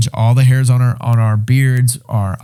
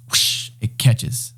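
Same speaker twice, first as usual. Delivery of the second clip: whispered whoosh; 7 dB quieter, much slower than usual. Retold or imitated. Fire catching.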